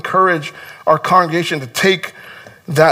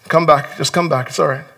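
usual speaking voice

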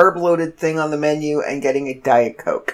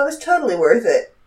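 normal talking voice

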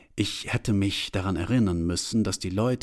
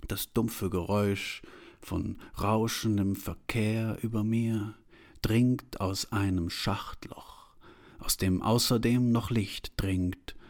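usual speaking voice

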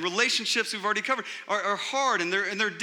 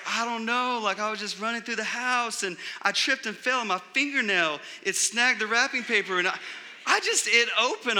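put-on higher-pitched innocent kid; kid making excuses; 5 semitones higher than usual. Kids explaining torn wrapping to parents, playing innocent. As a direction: plead innocence in a child's rambling excuse.

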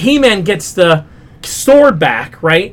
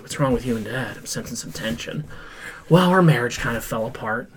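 low soft mock dialogue; 8 semitones lower, 11 dB quieter than usual. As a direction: lower and far softer, a lightly voiced family talk. Son and dad voiced low, soft.

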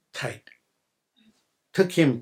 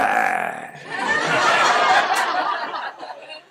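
big high burst of laughter; real amused laugh; 13 semitones higher than usual. Real, not acted. Laughing at the story.

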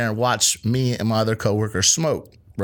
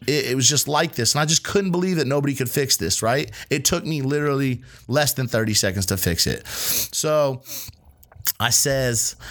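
ordinary talk

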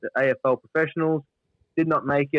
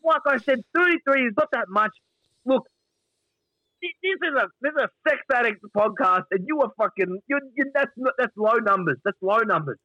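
high exasperated Gary; 9 semitones higher than usual. Retold or imitated. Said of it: "Gary's like".

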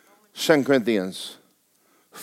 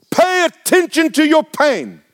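mock voice; 12 semitones higher, 4 dB louder, slower than usual. Mocking the world's advice.

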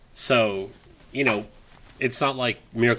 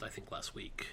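whispered aside; 20 dB quieter than usual. Real, not acted. Aside trailing off.

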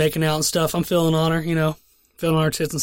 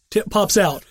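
usual speaking voice

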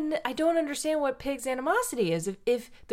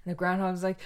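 ordinary talk

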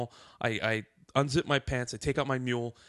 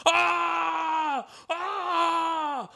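long high-pitched wail of dismay; imitating horrified fans screaming; collective scream. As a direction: scream out a drawn-out "ah" like a horrified crowd. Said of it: "like, screaming".

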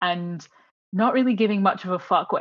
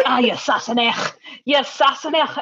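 mock Scottish; 5 semitones higher than usual. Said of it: "I was pretend".

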